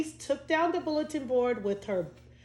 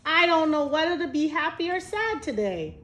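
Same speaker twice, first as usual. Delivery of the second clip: put-on torn child voice; 6 semitones higher, 5 dB louder than usual. A narrator's reading. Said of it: "said Teddy".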